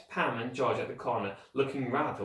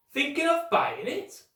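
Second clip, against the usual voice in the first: much higher, teasing; joking postman; 3 dB louder, slower than usual. A narrator's reading. Postman joking with the children, teasing. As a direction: tease the kids with a joke.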